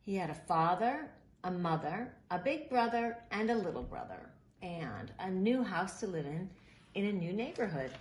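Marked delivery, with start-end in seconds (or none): none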